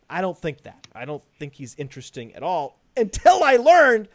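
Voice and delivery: normal talking voice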